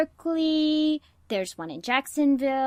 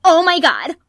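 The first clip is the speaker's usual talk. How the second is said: much louder and higher, a sudden starstruck squeal; fangirl shriek; 6 semitones higher, 14 dB louder than usual. Acted. Archmage's daughter spots her childhood hero, loud starstruck squeal. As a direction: blurt it out like you just spotted your idol. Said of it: "and she goes".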